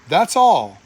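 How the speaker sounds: ordinary delivery, nothing marked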